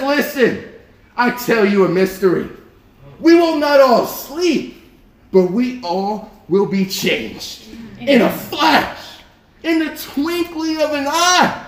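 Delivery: slow, stretched, emphatic verse reading; dramatic pulpit reading; preaching scripture. Acted. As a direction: proclaim it with high, drawn-out key phrases.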